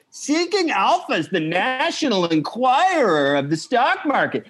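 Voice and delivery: put-on high, far-off grumbling old man; boomer impression. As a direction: ham up an out of touch boomer. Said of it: "my boomer fucking impression".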